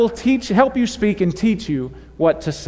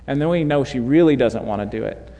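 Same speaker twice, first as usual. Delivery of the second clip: usual speaking voice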